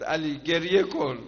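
high, loud grieving call; Fatima's plea. Acted. Dying Fatima calling to Ali, loud and high. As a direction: cry out to Ali, begging him to weep. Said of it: "صدا زد".

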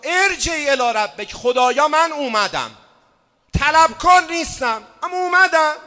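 high, loud cry to God; preacher crying out to God; loud plea. Acted. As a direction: call out to God boldly, not begging. Pleading to God.

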